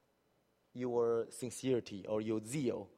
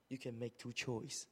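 usual speaking voice

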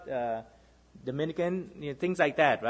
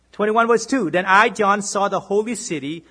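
louder, declaiming the verse; scripture reading; 3 semitones higher, 5 dB louder than usual. Acted. Preacher reads Revelation to the congregation, declaiming. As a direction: read the verse out to the church.

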